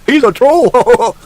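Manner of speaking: drawn-out mocking troll. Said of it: "tries to correct you".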